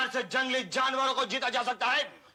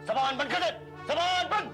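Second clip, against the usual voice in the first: angry command; 3 semitones higher than usual. Acted. Major ordering his wife to shut up, angry. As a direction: order building to a higher pitch.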